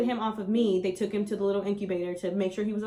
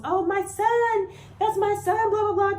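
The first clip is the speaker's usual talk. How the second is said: high, loud, slow mock scream of joy; retold scream; 13 semitones higher, 6 dB louder, slower than usual. Boyfriend screaming over his newborn son, a high, loud mock scream. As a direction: shriek it at the top of your lungs. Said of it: "he screamed to the top of his lungs".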